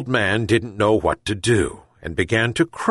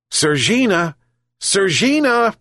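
high calls, rising higher on the second; shouted call; 10 semitones higher than usual. A narrator's reading. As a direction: call the cat insistently, pitched high. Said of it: "he shouted again".

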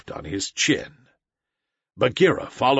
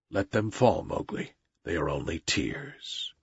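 put-on calm the crying boy, lower and softer; gentle panther Bagheera; 5 semitones lower, 5 dB quieter than usual. A narrator's reading. Bagheera comforts weeping Mowgli, low and soft.

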